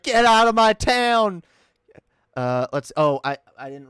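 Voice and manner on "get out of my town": louder, slower mock yell of the woman; mock angry woman. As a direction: ham up her angry shout a bit. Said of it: "being like".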